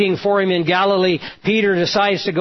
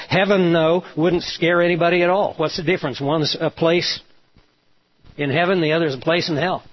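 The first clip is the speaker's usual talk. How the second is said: normal talking voice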